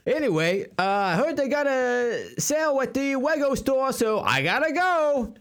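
high, evasive voice with muddled Rs; Bruce Wayne. Acted. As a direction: feign ignorance in a silly high speech-impaired voice. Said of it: "you have the same kind of a speech impediment".

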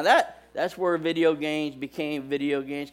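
ordinary delivery

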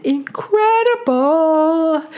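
INCREDIBLE stretched long and sung high; "Incredible" held long on high notes; drawn-out emphatic incredible. Real, not acted. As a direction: draw out "Incredible" in a high, amazed swoop. Exclaims about daughter's invented stories.

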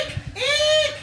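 high shrill mock screams; screaming crowd. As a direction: squeal like fleeing townsfolk. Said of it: "They're screaming".

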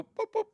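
high squeaky boop boop; mock boop sound. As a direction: chirp it like a cartoon.

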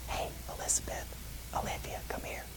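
put-on soft playful whisper calling the girls over. Dad on the couch whispering to his young daughters. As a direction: coax them closer in a hushed little voice. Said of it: "I would whisper in this little voice".

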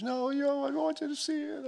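very high imitation of an insistent wife. Wife urging husband to see pictures, insistent. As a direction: pitch it way up, pleading. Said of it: "She goes".

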